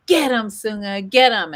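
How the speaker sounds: high, loud mock command; imitated egging-on. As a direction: imitate someone siccing the dog on playfully. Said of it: "Miley goes".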